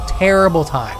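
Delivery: normal talking voice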